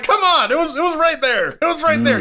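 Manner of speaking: high-pitched, half-laughing outrage; exasperated protest. Retold or imitated. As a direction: complain as if an obvious chance was wasted. Protesting a missed film quote.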